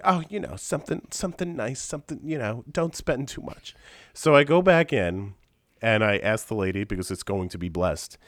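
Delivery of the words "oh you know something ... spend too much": higher, softer mother voice; hesitant, self-effacing mom impression; imitating mom. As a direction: play a meek, fussing mother. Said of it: "And she's like".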